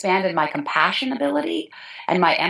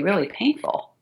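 ordinary talk

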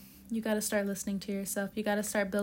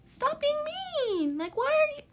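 put-on high, little-kid voice scolding Leo; childlike voice; 12 semitones higher, 4 dB louder than usual. A tarot reader scolding Leo in a child's voice, high and small. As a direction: squeak it out like a small child telling someone off. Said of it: "I don't even sound my age right now".

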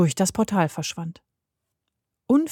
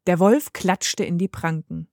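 plain reading voice

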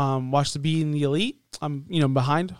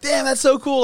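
impressed; 9 semitones higher than usual. Retold or imitated. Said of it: "And I'm like".

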